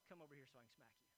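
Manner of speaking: soft, quick, low mock of mom; mom's quiet threat. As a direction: murmur it fast like a stern mom. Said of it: "my mother would tell us".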